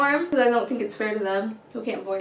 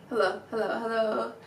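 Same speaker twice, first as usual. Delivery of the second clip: hushed, sleepy whispered greeting; just woken up on the phone; whispered hello; 8 dB quieter than usual. Acted. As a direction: breathe the hellos out softly. Pretend sleepy phone answer.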